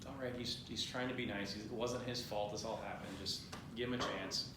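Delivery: calm him down gently, keep it soft; calm, cool-headed. Retold or imitated. Wife soothing her angry husband, calm and soft-spoken. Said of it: "Sophie has a cooler head".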